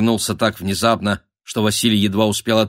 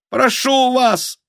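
very high, drawn-out thin plea; pleading squeak; 4 dB louder, slower than usual. A narrator's reading. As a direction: squeak it out over the din. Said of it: "прорывался писк". (умоляющий писк)

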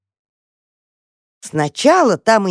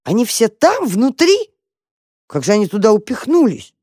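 raised, incredulous questioning; amazed; 5 semitones higher, 3 dB louder than usual. A narrator's reading. Mouse puzzles over people inside box, amazed. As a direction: wonder aloud, pitch lifted.

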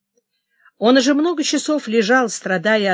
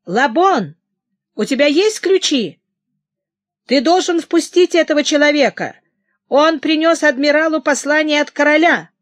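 громкий, высокий требовательный окрик; кричать через дверь, требуя впустить; крик; 6 semitones higher, 5 dB louder than usual. A narrator's reading. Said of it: "услышал крик Коссена". (громко кричать привратнику, приказывая открыть)

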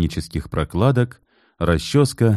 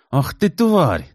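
angry curse; 9 semitones higher, slower than usual. A narrator's reading. Kit cursing wife.

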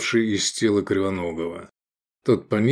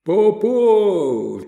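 high, loud roaring cheer; crowd roar; 10 semitones higher, 4 dB louder than usual. A narrator's reading. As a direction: roar from the stands. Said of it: "взревели трибуны".

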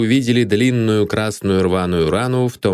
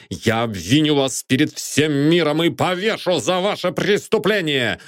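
berserk rage; 4 semitones higher than usual. Acted. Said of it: "в бешенстве закричал Белскомп".

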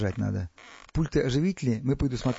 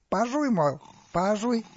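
mock quoting; 9 semitones higher, slower than usual. A narrator's reading. Pharaoh mockingly quotes Stas back.

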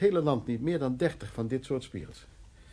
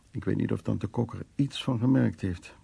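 normal talking voice

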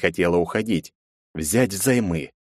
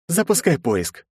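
ordinary delivery, nothing marked

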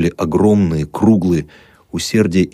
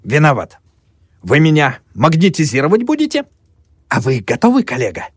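teasing; 8 semitones higher than usual. Acted. Moyer teasing.

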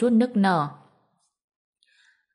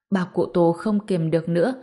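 usual speaking voice

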